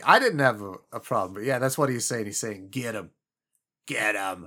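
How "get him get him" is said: low, soft Cockney mutter; Cockney accent. Retold or imitated. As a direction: growl it in old Cockney. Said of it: "the old Cockney".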